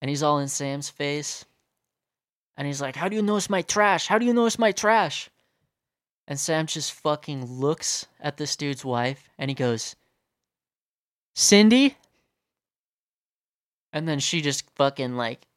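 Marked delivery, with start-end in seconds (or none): 3.0-5.3 high, raised mock confrontation; aggressive. Driveway intruder confronting the storyteller's friend, aggressive. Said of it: "this guy's definitely aggressive". 11.3-12.0 high, loud questioning call; pointed calling of a name. Retold or imitated. Friend suddenly naming the stranger's wife, high and pointed.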